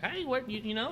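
high-pitched imitation of a customer; mimicked pushy customer. Restaurant worker imitates customers walking right up, mimicked pushy customer.

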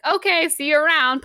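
loud, high, breezy retold sign-off; breezy, not offended. Owen, not offended, takes his leave, breezy. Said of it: "he's, like, not offended".

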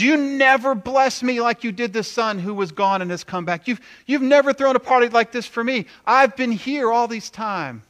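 high, loud, aggrieved complaint; indignant older son. Acted. Older son complains to his father, indignant and high. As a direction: protest hotly at being overlooked.

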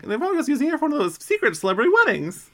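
high, fast squeaky delivery; high fast character voice. Retold or imitated. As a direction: rattle it off in a high voice. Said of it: "Agumon is like".

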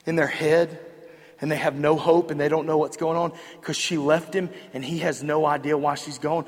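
normal talking voice

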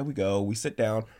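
ordinary delivery

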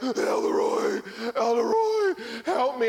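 desperate cry for help. Retold or imitated. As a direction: wail his name in despair. Member cries for help.

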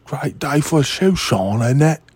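mock a badly faked, lisping Cockney chimney sweep.

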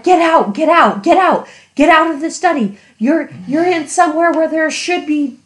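loud, high, urgent get out; urgent yelling. Retold or imitated. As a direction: yell at the kids to leave. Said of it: "I'm like".

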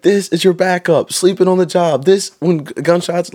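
high-pitched imitation of a berating trooper; play-acted public dressing-down; mock trooper tirade. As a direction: stretch it out like a drill lecture. Trooper berates recruit before the platoon.

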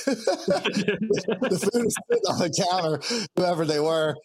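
high, slow words through laughter; real amusement breaking into the words; laughing while talking. Real, not acted. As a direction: laugh your way through the line. Laughs at the waiting food order.

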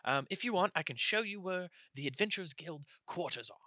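high, piping gnome voice; squeaky gnome messenger. Acted. Gnome telling adventurers where to meet, high piping voice. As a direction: recite directions as a small eager gnome. Gnome offers to show quarters.

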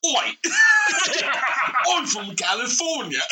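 put-on laughing through a British accent; British voice breaking into laughter. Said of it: "impression of a British doing California accent".

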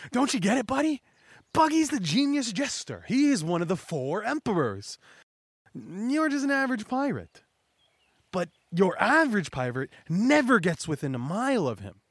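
high, hammed-up henchman voice; boastful lackey. Buggy's lackeys grabbing the old man, high boastful voice. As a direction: brag about your boss in a high put-on voice.

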